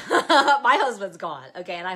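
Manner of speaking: loud laugh running through the words; giggling at her own escapade. Real, not acted. Laughing at being caught.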